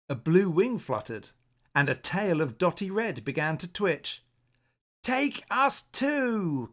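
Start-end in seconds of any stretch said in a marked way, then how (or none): 5.0-6.7 high, slow, stretched-out plea; eager plea. Acted. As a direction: call it out eagerly, drawn out. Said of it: "cried the pretty owl".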